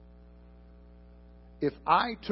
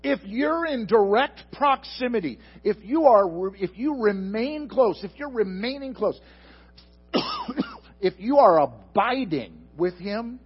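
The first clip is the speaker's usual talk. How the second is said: raised, higher, louder preaching voice, building; fervent preaching; 7 semitones higher, 6 dB louder than usual. Acted. Pastor stirs the congregation about abiding, fervent and loud. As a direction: preach it out full force to the back row.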